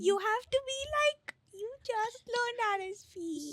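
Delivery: put-on high, fast baby talk. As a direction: squeak like a toddler. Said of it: "Are you a ten-year-old baby".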